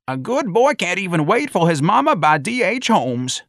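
put-on high, loud old-timer's complaint; old bystander griping at high pitch; indignant old man. A narrator's reading. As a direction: protest as an outraged old man. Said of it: "It was the old man again".

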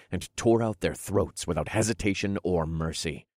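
usual speaking voice

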